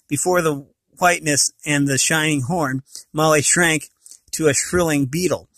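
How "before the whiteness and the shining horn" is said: usual speaking voice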